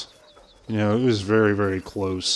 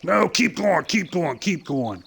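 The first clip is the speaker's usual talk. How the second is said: starting much higher, settling down; urging on; 8 semitones higher than usual. Retold or imitated. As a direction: push him further, then ease off. Dad urging kid further out.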